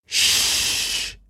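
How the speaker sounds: a soft, breathy shush to silence him. Acted. Captain hushes Garland at the bridge door, whispered shush. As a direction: hush him without voice.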